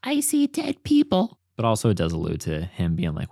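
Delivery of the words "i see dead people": put-on high, slow spooky child voice; breathy ghost-seer line from the film; a hammed-up creepy kid; creepy movie kid quote. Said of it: "I felt like that was very".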